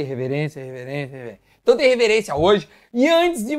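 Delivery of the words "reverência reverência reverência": imitating Fari. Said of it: "Como diz o Fari".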